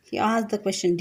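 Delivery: usual speaking voice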